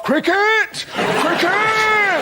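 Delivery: high, hearty shouted cry; Telegraph. Acted. Newspaper with one obsession calling out, high drawn-out cry. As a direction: whoop the one word with a long high finish. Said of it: "Tell 'em, Telegraph".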